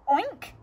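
loud high oink; oinking pig. Acted. Cats oink.

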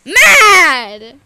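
loud, stretched single shout; tantrum. Pretend. Cutting off a puzzled onlooker, loud tantrum shout. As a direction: bellow it in mock fury.

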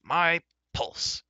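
high, exclaimed oath; hearty cry. A narrator's reading. Giant king hugs the bard, crying out heartily. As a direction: cry it out in booming delight. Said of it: "he cried".

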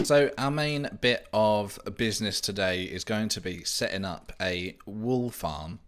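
normal talking voice

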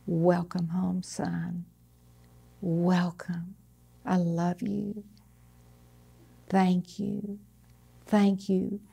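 soft, hushed and gentle, almost whispered; tender welcome. Retold or imitated. Preacher voicing God welcoming Jesus home, softly and tenderly. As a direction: murmur it like a father greeting a son home. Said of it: "when God said".

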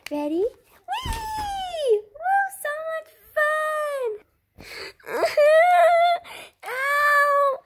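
put-on high squeaky kid, shrieking with glee; little girl voice. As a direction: play an excited little girl doing flips. Child doll playing gymnastics.

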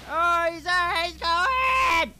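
high, loud, dragged-out alarm; alarmed shout. Real, not acted. Gamer sees the monster escaping, loud alarmed call. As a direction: call out in panic as he flees.

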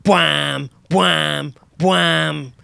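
three long brass-like bwams; imitated horn alarm. Comedian voices the work alarm as sustained horn noises. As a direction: imitate booming horns, each held. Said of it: "There's the alarm again".

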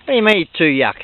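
ordinary talk, nothing marked

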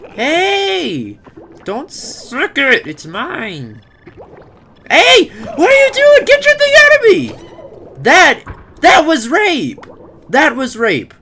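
high, loud hammed-up outrage; outraged screaming. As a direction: scream in mock indignation. Creature attacks him.

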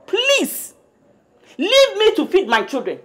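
high pleading please; exasperated mother's pleading please. Acted. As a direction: cry it out, fed up.

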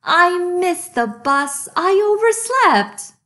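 high, loud whiny wail; tearful piggy. Acted. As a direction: sob it out like a panicked piglet. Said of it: "poor Piggy wept".

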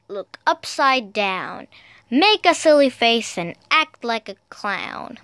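ordinary delivery

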